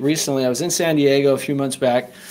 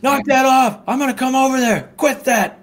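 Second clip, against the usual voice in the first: much higher, pitched-up mock yell at the homeless man; retold yelling; 10 semitones higher than usual. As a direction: bark it across the street like a threatening valet. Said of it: "he's yelling at the homeless man".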